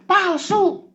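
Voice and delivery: squeaky high voiced caller insisting it's fake; high mock voice. Comic skit, fake-accuser voice scoffs Palsu.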